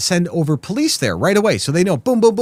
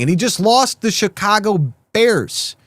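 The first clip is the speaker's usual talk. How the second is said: usual speaking voice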